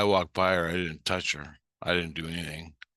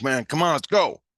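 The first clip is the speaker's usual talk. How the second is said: impatient urging; 8 semitones higher, 6 dB louder than usual. Retold or imitated. Retold urging slow walkers on.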